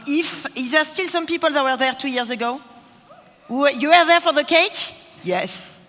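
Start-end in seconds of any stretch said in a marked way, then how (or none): 3.5-4.9 calling out. Real, not acted. Calling out to audience.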